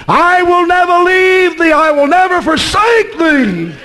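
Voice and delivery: high, drawn-out preacher's proclamation; declaring God's promise. Retold or imitated. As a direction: proclaim the promise with full conviction.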